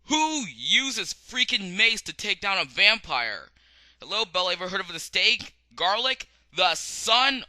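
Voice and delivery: loud incredulous rant; exasperated. Real, not acted. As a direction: scold her in disbelief.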